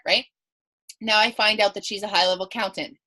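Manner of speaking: ordinary talk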